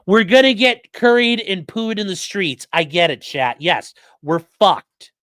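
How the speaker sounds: loud, slow mock-doom rant; sarcastic rant. Real, not acted. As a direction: hammer each word, fed up. Sarcastic ranting at chat.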